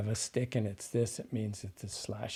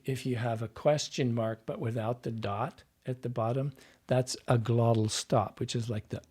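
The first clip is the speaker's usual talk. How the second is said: normal talking voice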